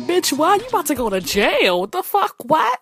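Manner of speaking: shocked disbelief. Retold or imitated. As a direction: react like your friend just confessed something wild. Shocked texting friend about jail.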